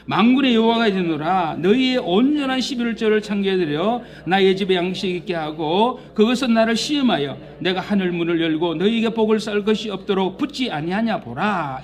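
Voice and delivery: loud, solemn Bible reading; scripture reading. Acted. Preacher reads Malachi ten aloud, solemn and loud. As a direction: proclaim the verse to the congregation.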